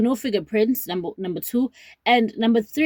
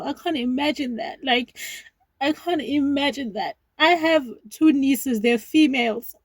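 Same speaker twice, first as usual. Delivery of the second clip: tearful disbelief; 5 semitones higher than usual. Real, not acted. Grieving, just said she's crying.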